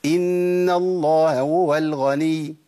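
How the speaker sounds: إن الله هو الغني with the ه of الله and هو both pronounced, slow; slow recitation, each ه sounded separately; both h sounds clear. Shown as a demonstration. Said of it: "تاني". (نطق الهاءين بوضوح في الله هو)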